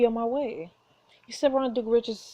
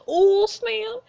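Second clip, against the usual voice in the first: gleeful; 13 semitones higher, 7 dB louder than usual. Real, not acted. Cheering the elbow hit.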